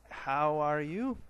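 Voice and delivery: "How are YOU?", slow and loud, rising on "you"; stress on YOU. Shown as a demonstration. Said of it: "Notice the accent".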